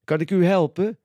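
eager salesman asking to help; cheerful shopkeeper. A narrator's reading. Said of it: "straalt meneer Vos".

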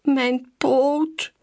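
high, drawn-out whimper. A narrator's reading. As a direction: moan about the hurt paw. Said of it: "jammert Loebas".

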